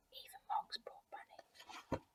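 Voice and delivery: sorry whisper; hushed sympathy. A narrator's reading. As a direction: whisper it with pity. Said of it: "whispered sad aside".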